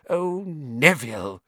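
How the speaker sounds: slow weary granny sigh; sighing old woman. Acted. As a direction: sigh it like a tired grandmother. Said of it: "he heard the old woman sigh".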